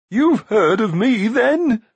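high, loud, hopeful question; eager giant. A narrator's reading. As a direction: ask eagerly, voice lifting and quavering. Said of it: "he asked eagerly".

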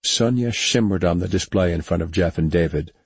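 ordinary delivery, nothing marked

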